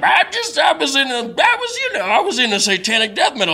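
high, squeaky stammering excuse; squeaky flustered voice. Retold or imitated. Homeless man's flustered excuse.

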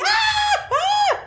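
very high mock screams; women screaming. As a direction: shriek like women in the distance. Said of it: "We could hear women screaming".

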